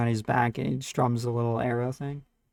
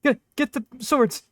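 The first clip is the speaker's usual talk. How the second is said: high, slow panicked orders; mocking a hiding coward; 11 semitones higher, slower than usual. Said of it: "hides behind a pillar like".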